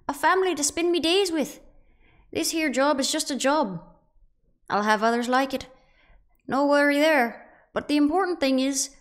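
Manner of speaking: high, loud, hammed-up Irish lilt; bad Irish accent. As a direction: overdo a terrible Irish accent for a wistful speech. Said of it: "I'm gonna try my worst Irish accent".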